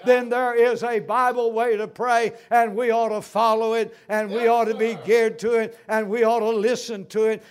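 loud, high, driving repetition; preaching at full force. Real, not acted. Preacher rousing the congregation, loud and high. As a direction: drive the triple point home.

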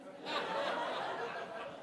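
a short high-pitched chuckle; high laugh. Real, not acted. Preacher chuckling at listeners who doubt the story, high laugh.

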